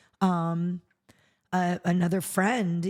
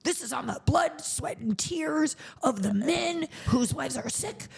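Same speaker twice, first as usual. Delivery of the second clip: indignant George; 8 semitones higher than usual. Retold or imitated. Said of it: "He's like".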